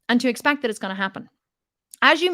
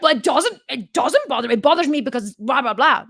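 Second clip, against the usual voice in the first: defensive; 2 semitones higher, 5 dB louder than usual. Retold or imitated. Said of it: "And then they're like".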